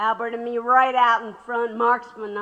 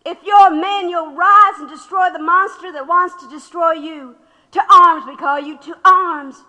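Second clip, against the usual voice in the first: loud, rising rallying cry; fiery call to arms; 5 semitones higher, 9 dB louder than usual. Retold or imitated. Widow reading the flyer aloud as a loud call to arms. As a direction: rouse the crowd to fight. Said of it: "Something like".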